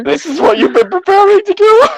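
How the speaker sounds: high squeaky mock disbelief; incredulous mock quote. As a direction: repeat it as if you can't believe it. Mock repeating the line incredulously.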